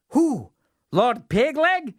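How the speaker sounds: a silly fool not getting it; puzzled fool. Acted.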